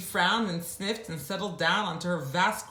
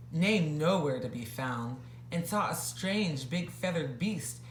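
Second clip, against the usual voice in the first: usual speaking voice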